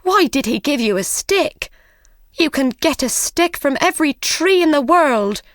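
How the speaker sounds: mother angrily scolding her son; angry scolding. Acted. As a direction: scold him for bringing a stick. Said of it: "cried his mother angrily".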